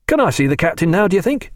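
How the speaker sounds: bursting with delight, lightly voiced; Tom Pullings voiced. A narrator's reading.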